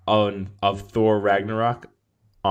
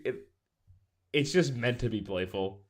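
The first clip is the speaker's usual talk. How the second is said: ordinary talk, nothing marked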